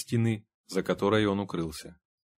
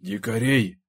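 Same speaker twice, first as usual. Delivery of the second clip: sudden, emphatic exclamation; abrupt, forceful outburst; blurted out; 4 dB louder, slower than usual. A narrator's reading. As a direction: blurt it out without raising the pitch. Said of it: "выпалил Сайлас".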